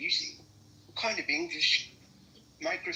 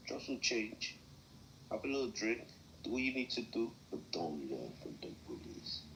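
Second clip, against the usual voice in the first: hushed earnest plea; 4 semitones lower, 10 dB quieter than usual. Acted. Earnest advice against bullies.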